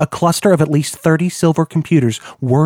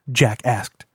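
ordinary delivery

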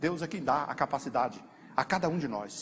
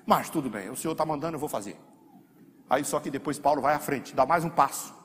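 usual speaking voice

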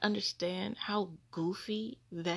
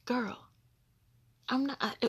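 ordinary talk

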